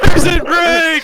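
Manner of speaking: long high screamed cry; screaming. Pretend. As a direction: scream it to the sky. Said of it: "Screaming into the sky".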